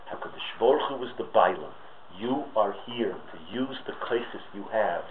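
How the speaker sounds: soft, earnest sermon voice; quiet preaching. Acted. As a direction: lean in and press the lesson home gently. Quiet earnest moral lesson.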